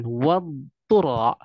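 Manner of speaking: Wadtura very slow, dhad held long; stretched dhad. Shown as a demonstration. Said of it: "Jangan sampai diidghamkan".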